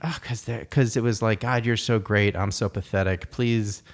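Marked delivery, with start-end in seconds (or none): none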